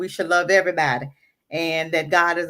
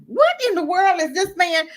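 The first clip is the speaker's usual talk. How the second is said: shocked; 9 semitones higher than usual. Real, not acted. Shocked by the Pope clip.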